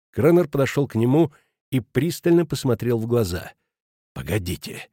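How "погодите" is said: low, soft, restrained; catching his breath. A narrator's reading. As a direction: pull back from the shouting to a low voice. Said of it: "перевёл он дыхание". (тихо, переводя дыхание)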